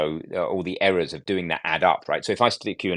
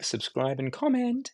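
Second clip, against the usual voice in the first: put-on high, lilting teen girl voice; teenage girl voice; 13 semitones higher, slower than usual. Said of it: "It's hard not to say that in a teenage girl's voice".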